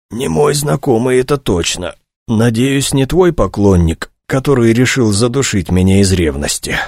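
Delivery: plain reading voice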